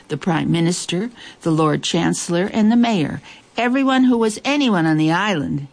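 ordinary delivery, nothing marked

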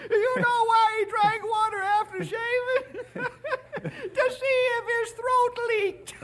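high cackling punchline delivery; tickled by your own joke, barely getting it out; laughing old-timer. Acted. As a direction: squeal the words through laughter. Joker cracks up at own joke.

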